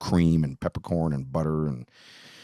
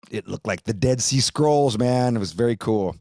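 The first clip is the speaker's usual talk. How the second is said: louder, higher, excited; 5 semitones higher, 5 dB louder than usual. Real, not acted. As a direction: gush about it. Enthusing about paper.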